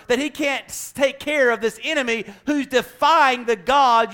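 high, loud rebuke; calling out the army too scared to fight, loud; full force preaching. Acted. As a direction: preach it hard at the crowd.

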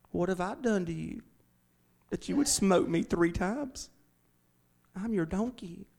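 quietly hurt, asking why it was beaten; sad donkey. Acted. Donkey pleads sadly with its master, hushed and hurt.

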